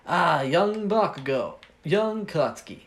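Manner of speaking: big, slow acted greeting; hearty uncle greeting. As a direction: play an uncle hailing his nephew. Uncle greets young Bakugo back.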